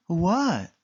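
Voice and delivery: quick curious question; Callie asking. Acted. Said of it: "Callie asked".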